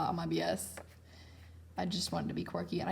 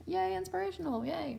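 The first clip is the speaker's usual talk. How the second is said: much higher, sing-song mock cheer; hammed-up inspirational yay; mock peppy; 11 semitones higher than usual. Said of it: "something peppy and happy".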